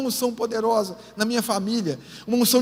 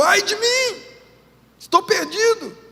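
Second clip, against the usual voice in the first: Isaiah's lament; 10 semitones higher, 5 dB louder, slower than usual. Acted. Isaiah before God's glory.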